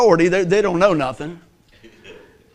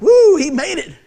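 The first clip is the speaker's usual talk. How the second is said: mock cheer; 9 semitones higher, 6 dB louder than usual. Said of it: "to be like".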